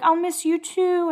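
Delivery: high, slow, drawn-out sweet imitation; retold fond farewell. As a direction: imitate a friend's tender goodbye, high and slow. Retold tender farewell between friends.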